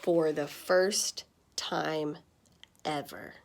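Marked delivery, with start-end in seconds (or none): none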